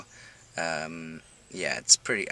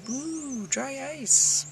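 playful excitement; 4 dB quieter, much slower than usual. Real, not acted. Excited about dry ice.

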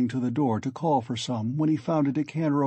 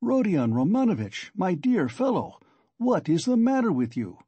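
higher, mildly alarmed plea; a touch of fright; frightened; 6 semitones higher than usual. A narrator's reading. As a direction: appeal to him in worried astonishment. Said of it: "apparently quite frightened".

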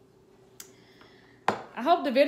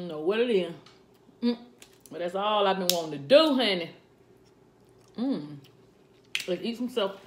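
talking while eating; wiping mouth with napkin; chewing with mouth full; 3 semitones lower than usual. Real, not acted. Seen 3: Eats crab, lips working; napkin wiped across mouth midway.

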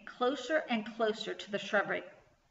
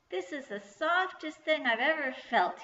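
higher-pitched, lightly voiced goblin wonder; delighted goblin; 6 semitones higher than usual. A narrator's reading. Goblin pressing sweater to his cheek, delighted. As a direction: marvel in a raised goblin voice.

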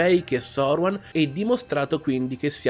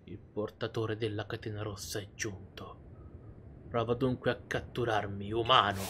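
low, hushed voice of a deity; telepathic voice; 4 semitones lower, 7 dB quieter than usual. Acted. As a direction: speak softly, inside their head. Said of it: "Una voce nella mia testa?".

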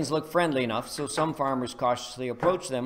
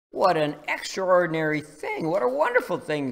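high and louder, amazed; exclaiming; 5 semitones higher, 6 dB louder than usual. Acted. Aliens thrilled by the news of Jesus, exclaiming. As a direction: marvel out loud. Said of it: "exclaimed the aliens".

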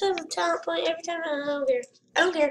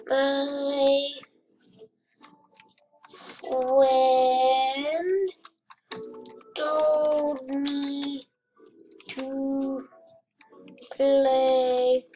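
very slow, each word drawn out; word by word, long held vowels; dictating while typing; much slower than usual. Real, not acted. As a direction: stretch out every word as you type it.

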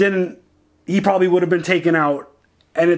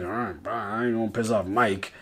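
meek bartender; 6 semitones lower, 9 dB quieter than usual. Retold or imitated. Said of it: "Bartender's like".

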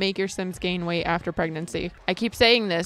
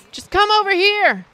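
calling out; 11 semitones higher, 6 dB louder than usual. Real, not acted. Calling Sim over.

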